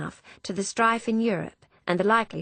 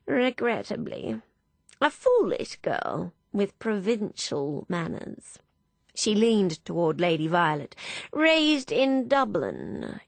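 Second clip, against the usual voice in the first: put-on slow, haughty old lady voice; disdainful dowager aside; snobbish disdain; 3 semitones higher, slower than usual. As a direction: sniff at the girl, drawn out. Clementine sniffy disdain.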